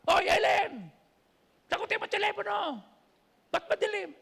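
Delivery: high, loud yelling call; Ebet yelling. Retold or imitated. Ebet yells at Ellen to answer the phone, high and loud. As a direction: yell across the house to Ellen. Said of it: "Sabi ni Ebet".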